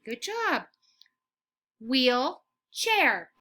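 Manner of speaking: normal talking voice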